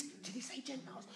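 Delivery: whispered, scandalised question. Acted. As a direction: lean in and whisper it. Crowd member whispering.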